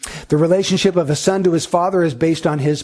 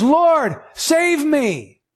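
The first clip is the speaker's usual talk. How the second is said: high desperate plea, pitch raised; desperate cry; 9 semitones higher, much slower than usual. Retold or imitated. Preacher voicing sinking Peter calling to the Lord, desperate.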